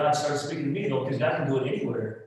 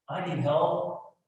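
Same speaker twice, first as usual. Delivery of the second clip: ordinary talk, nothing marked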